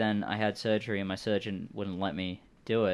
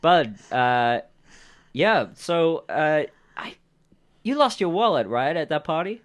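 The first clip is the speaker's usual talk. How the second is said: higher, louder, over-eager character voice; bluffing stranger; 6 semitones higher, 7 dB louder, slower than usual. Acted. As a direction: seize on the cover story eagerly. Game master voices bluffing stranger.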